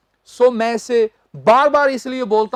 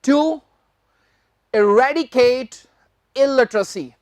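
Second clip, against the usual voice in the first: slow; much slower than usual. Shown as a demonstration. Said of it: "teacher reads headline slowly".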